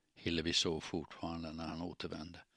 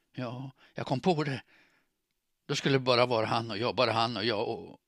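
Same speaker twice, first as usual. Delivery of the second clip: higher, louder, worked-up confession; distraught confession; 4 semitones higher, 6 dB louder than usual. A narrator's reading. Woman picturing life with him, distraught. Confession before breaking into tears.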